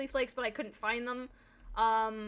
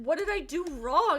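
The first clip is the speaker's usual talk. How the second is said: louder, higher bewildered plea; exaggerated what-have-I-done; hammed-up protest; 5 semitones higher, 5 dB louder than usual. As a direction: imitate a partner pleading innocence. Imitating partner's repeated protest.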